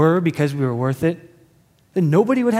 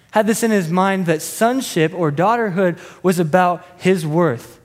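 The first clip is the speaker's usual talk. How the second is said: usual speaking voice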